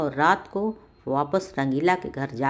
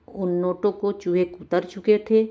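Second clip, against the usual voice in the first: ordinary delivery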